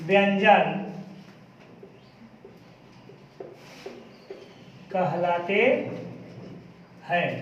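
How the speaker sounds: word by word with long pauses; slow dictation. Shown as a demonstration. Teacher dictating a definition to pupils, word-by-word dictation. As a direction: dictate slowly, leaving time to write each word.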